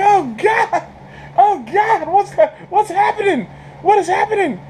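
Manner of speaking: very high-pitched mock alarm; shocked outcry. Moviegoer watching a hero get shredded, high mock horror. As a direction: cry out in hammed-up disbelief. Retold shocked reaction to screen death.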